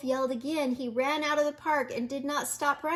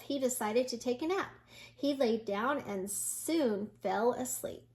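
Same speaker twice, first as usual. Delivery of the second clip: usual speaking voice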